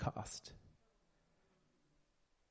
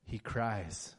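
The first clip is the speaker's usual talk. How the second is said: ordinary talk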